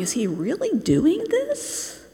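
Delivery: high, slow, incredulous question; scandalized disbelief. Acted. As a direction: Pharisee gawking in disbelief, voice swooping up. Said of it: "picture the Pharisees ... looking in the door, going".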